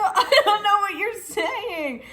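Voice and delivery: high, drawn-out exasperated cry; mock wail. Retelling her answer to his night screaming, mock wailing. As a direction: wail back, hammed-up and helpless.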